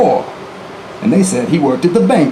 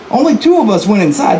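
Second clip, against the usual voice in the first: usual speaking voice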